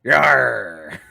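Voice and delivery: drawn-out pirate "yar"; mock pirate affirmative; pirate growl. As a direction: answer like a hearty pirate, lightly hammed. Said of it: "that's what he says".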